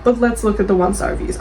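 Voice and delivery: ordinary talk, nothing marked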